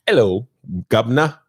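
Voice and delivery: hammy English greeting; bad cockney-style English accent. Shown as a demonstration. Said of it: "let you know that my English accent is my strongest".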